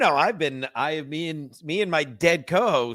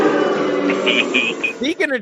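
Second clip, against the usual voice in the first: hammed-up segue; 6 semitones higher, 5 dB louder, much slower than usual. Segue bit over music.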